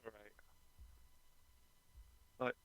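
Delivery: usual speaking voice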